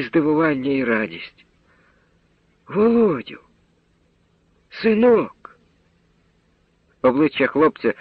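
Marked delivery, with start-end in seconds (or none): 2.7-5.6 high cry of surprise and joy; joyful recognition. A narrator's reading. Soldier recognizing his lost son. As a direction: call out the son's name in wonder.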